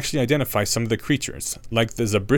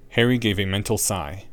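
ordinary delivery

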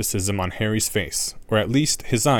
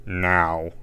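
stern teacher; 4 semitones lower than usual. A narrator's reading. Snape commands class.

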